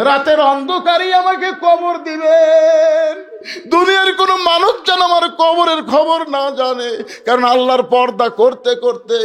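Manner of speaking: high, loud chanted entreaty; acted wailing plea. Dying Fatema asking Ali for a night burial, wailing plea. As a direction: cry out a last wish, pitched high. Dying wife's impassioned last request.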